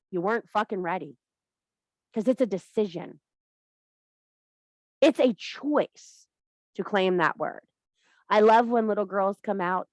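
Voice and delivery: ordinary delivery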